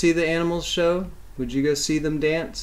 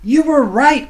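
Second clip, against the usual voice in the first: high, loud happy call; mother calling out; 11 semitones higher, 9 dB louder than usual. Acted. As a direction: call out to her dancing son. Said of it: "called his mother".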